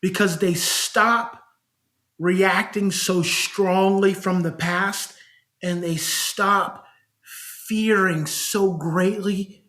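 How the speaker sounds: slow it right down, pause between phrases; measured, drawn out; slow and deliberate. Acted. Slow deliberate telling of how parents change.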